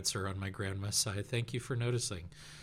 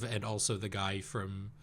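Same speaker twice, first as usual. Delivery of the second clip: ordinary talk, nothing marked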